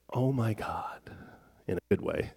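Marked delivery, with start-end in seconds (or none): 0.1-1.0 breathless whispered awe; awed whisper. Retold or imitated. Scientist marveling at creation, awed whisper. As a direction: hush it like you're stunned by beauty.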